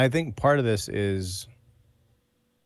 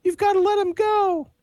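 mock Southern American accent pitched way up; overdone Southern twang; 5 dB louder than usual. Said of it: "generic Southern American twang accent".

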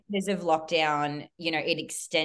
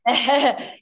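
bursting laugh; 7 semitones higher, 7 dB louder than usual. Real, not acted. Guest laughs at host's joke.

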